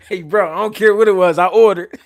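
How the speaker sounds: loud, emphatic repeat of the punchline. Real, not acted. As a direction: belt it out to make the point.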